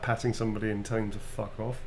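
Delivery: ordinary talk, nothing marked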